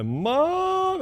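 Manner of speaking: put-on high, loud kid's call for mom; child calling mom. Little son blocked by dad calls out to mom, high yell. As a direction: imitate a little boy yelling for help.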